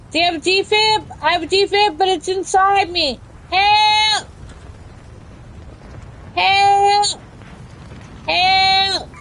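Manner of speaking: high, pleading cry of help; calling for help. Pretend. Downed streamer calls teammates for help, high pleading cries.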